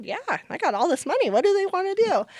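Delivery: high, loud and fast, all in; eager. Retold or imitated. Founder eagerly offering money to the doctor, excited. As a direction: jump at the chance to help.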